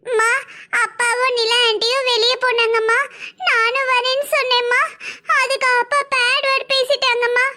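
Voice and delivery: put-on high little kid's voice, telling tales on dad; small child complaining sweetly to mom. Little son tells mother what dad said, high child voice.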